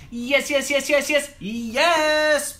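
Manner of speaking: high, rapid-fire squealed yeses; mock porn noises. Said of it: "they're talking about porn noises".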